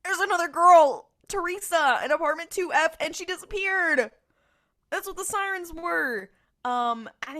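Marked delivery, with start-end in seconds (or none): 0.0-6.3 high, loud breathless-news shout; hammed-up excited shouting, easing slightly; barging-in announcement. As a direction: burst in hollering the news. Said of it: "barging in like the Kool-Aid man".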